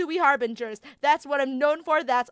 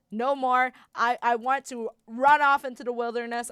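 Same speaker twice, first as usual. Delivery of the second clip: ordinary delivery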